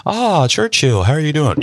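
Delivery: high-pitched, hearty mock greeting; imagined greeter of Churchill. Someone greets Churchill by name, mock hearty greeting. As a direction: hail an important man, pitched well up. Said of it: "And they'd be like".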